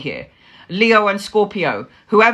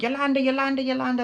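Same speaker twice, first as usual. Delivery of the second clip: high, softer singsong repeats; joking call; 5 semitones higher, 5 dB quieter, faster than usual. Retold or imitated. Mother teasing daughter with a name, joking high call. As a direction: chant the name high as a tease. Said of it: "as a joke".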